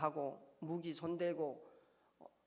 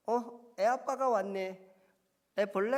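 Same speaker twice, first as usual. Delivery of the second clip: cheerful doctor; 5 semitones higher, 7 dB louder, slower than usual. Retold or imitated. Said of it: "해맑은 웃음으로 저에게 물어봤어요".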